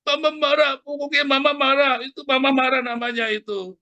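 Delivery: very high-pitched mock tantrum; imitating tantruming child. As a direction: protest stubbornly like a told-off kid. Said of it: "begitu namanya tantrum itu".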